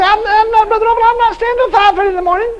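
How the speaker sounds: high, whiny mock protest; mock complaining listener. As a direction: imitate a reluctant churchgoer. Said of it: "You say".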